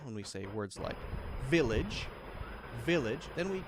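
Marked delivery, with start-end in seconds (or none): 1.5-3.2 "village" with a strong V, voice rising; V sound. Shown as a demonstration. Teacher giving V words, "village" with emphatic V. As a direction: punch the V of "village", ending upward. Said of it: "whenever we wanna make the V sound".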